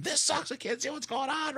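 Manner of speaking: very high, thin imitation of yelling fans; mock scream. Host imitating fans complaining about the camera view, mock scream. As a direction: squeal it softly like a screaming crowd. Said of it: "unanimously people screaming".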